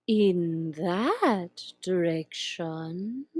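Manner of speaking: slow, purring Cheshire Cat voice; slow drawling cat. Acted. Grinning cat teasing the lost girl, slow and drawn out. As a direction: stretch each word like a lazy cat.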